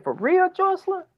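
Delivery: can't believe what he hears; disbelief. Retold or imitated. Father to daughter on the phone, high incredulous cry.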